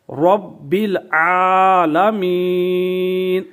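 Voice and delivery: slow chanted رب العالمین with long عا; تجوید کے ساتھ تلاوت; Quranic recitation. Shown as a demonstration. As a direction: recite it slowly, drawing out the vowel. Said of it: "تو آپ پڑھیں گے".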